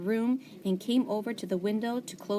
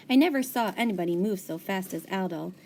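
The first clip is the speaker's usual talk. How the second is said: ordinary delivery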